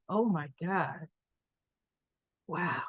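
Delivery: soft, low, slow amazement; hushed awe. Retold or imitated. Viewer moved by Eddie's song, hushed and awed. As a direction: let it out quietly, as if moved.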